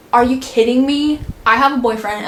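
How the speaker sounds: loud, indignant retort, pitch jumping up; retold angry outburst. Woman telling off a pushy stranger in a store, indignant. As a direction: re-enact snapping at a creep in disbelief. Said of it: "'cause I was mad".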